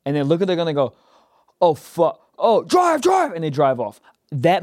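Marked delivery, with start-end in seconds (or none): 1.6-3.3 high, urgent getaway cry; pitched-up panicked command; mock panic. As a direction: imitate someone scared urging the driver to go. Acts drivers fleeing from a gun.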